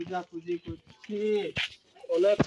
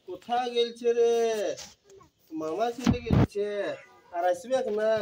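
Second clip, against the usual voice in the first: put-on child's voice; 5 semitones higher, 5 dB louder than usual. Child's side of the dialogue.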